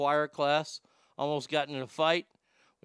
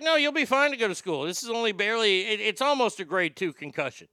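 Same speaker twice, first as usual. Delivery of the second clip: doctor voice; 6 semitones higher, 3 dB louder than usual. Retold or imitated. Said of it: "He's like".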